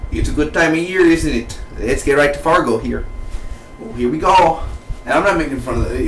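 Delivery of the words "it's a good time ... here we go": higher, put-on Fargo accent. Reviewer opening the Fargo recap, in an imitation Fargo accent. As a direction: play up a folksy Fargo accent. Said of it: "if you talk like this".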